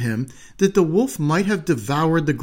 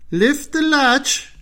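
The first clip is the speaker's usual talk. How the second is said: put-on higher, slow old woman's voice; old grandmother calling; 11 semitones higher, 3 dB louder, slower than usual. Grandmother calling from bed to the door, frail. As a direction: call out like a frail old granny. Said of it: "called out the grandmother".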